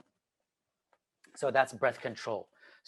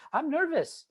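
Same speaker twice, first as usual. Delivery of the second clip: "I'm nervous" said high and lightly; a small honest admission to yourself; gentle high confession; self-talk; 13 semitones higher, 3 dB quieter than usual. Shown as a demonstration. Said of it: "say to yourself".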